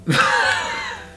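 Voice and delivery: sudden squeal of laughter; high squealing laugh. Real, not acted. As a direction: burst out in a high shriek of laughter. Breaks up reading the card.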